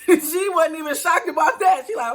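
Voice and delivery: high, loud laughing delivery; real laughter at the story. Real, not acted. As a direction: laugh through the words. Laughing at friend's calm.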